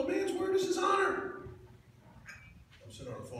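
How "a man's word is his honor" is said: very high excited squeal on "A man's word is his honor"; mock high-pitched coach yelling the motto; high squeaky coach voice. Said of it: "his voice went up a couple of octaves".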